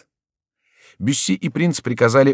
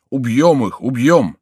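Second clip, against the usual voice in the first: loud, raised threat; mob shouting; 4 semitones higher, 5 dB louder, slower than usual. A narrator's reading. Townsfolk threatening the riders, shouting. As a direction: shout as an angry crowd. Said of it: "закричали граждане".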